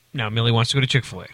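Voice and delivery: normal talking voice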